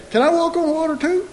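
high, quick, carefree mock-excited; flippant thrill-seeker. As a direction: toss it off like a kid wanting a ride. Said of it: "He didn't say".

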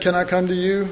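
soft, earnest request; a hushed, earnest Peter; quiet sincere plea. Acted. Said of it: "He said".

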